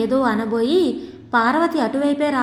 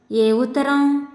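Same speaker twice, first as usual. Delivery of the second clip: plain reading voice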